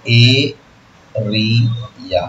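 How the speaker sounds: एरिया drawn out very slowly from its opening vowel; teacher models एरिया slowly for students; slow pronunciation demo. Shown as a demonstration. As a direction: stretch एरिया slowly to show its vowel start. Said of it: "तुम कैसे बोलो?".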